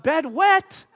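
incredulous rising question. Retold or imitated. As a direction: echo it back in disbelief. Dad repeats it in disbelief.

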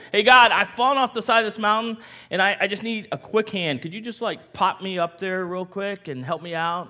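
high, loud call upward, easing off; hiker pleading casually. Acted. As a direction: ask a big favor breezily. Hiker calls up to God.

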